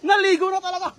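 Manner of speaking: high, fast laughing remark. Real, not acted. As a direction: laugh it out, amused. Companion slipped into water.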